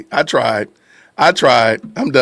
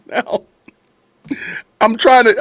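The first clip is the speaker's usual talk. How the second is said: ordinary talk, nothing marked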